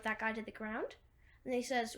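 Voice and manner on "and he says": usual speaking voice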